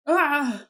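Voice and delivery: short loud "Ah!" of fright; sudden startled cry, played up; startled scream. Girl woken by her auntie in her room, startled cry. Startled awake by aunt in game.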